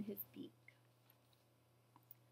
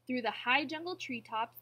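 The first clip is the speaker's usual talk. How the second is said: plain reading voice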